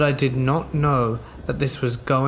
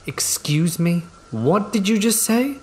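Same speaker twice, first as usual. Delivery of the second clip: rising, much higher indignant shout; retold yell; 7 semitones higher than usual. Confronting the neighbour who insulted her sister, indignant yell. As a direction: demand it back at him, pitch climbing. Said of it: "This is when I yell at him".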